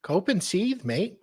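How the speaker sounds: high attempt at Australian; bad Aussie accent, starting high and dropping. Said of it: "Nah, forget it".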